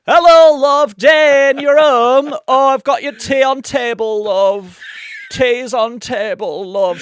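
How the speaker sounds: high, loud northern mum voice, slow; a homely Yorkshire cook greeting her man; hammed-up northern wife, high and loud. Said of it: "she's like".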